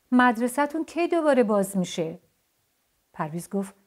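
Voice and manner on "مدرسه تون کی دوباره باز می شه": high, loud call across the room; shouted question. A narrator's reading. Lucy calls to Parviz from across the room, shouting. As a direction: call out the question to someone far off. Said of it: "لوسی از اون طرف اتاق داد کشید".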